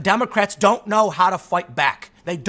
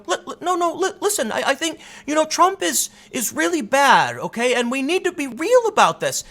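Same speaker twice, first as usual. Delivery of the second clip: high-pitched stammering mock politician; mocking plea; 8 semitones higher than usual. Democrats on stage pleading with voters, mocking high stammer. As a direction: plead haltingly in a raised, put-on voice.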